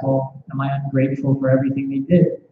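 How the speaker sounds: normal talking voice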